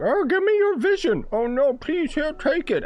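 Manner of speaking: high, squeaky cartoon voice; mock villain and victim. Streamer imitating a tame vision robbery, high mock voices. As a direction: play a silly, hammed-up robbery in high pitch. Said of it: "gonna be like".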